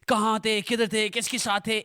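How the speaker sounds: mildly accusing parent's questioning; retold interrogation, question after question; interrogating tone. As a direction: imitate a parent's pointed questioning. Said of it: "In that tone".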